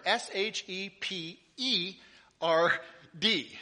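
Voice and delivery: each letter of "S H E P E R D" said slowly and apart; spelled out slowly. Shown as a demonstration. Pastor spelling the misprint for the church, letter by letter. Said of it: "there's no H in shepherd".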